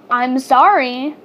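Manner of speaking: usual speaking voice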